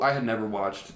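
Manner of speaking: normal talking voice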